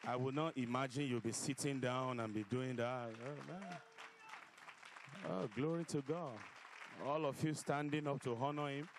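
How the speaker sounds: loud, ringing preacher voice; fiery preacher sermon. Acted. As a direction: preach it out to the hall. Preaching at full force.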